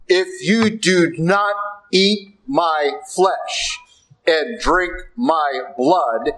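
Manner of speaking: very slow, weighty, each word laid down; solemn scripture quote. Acted. Preacher quoting Jesus to the congregation, very slow and solemn. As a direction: let the gospel words land one by one.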